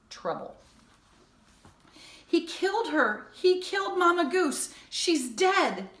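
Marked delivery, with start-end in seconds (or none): 2.3-5.9 high, loud outraged cry; anguished yelling; boy's shout. A narrator's reading. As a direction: shout out the horror. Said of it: "The words erupted from Jonathan in a shout".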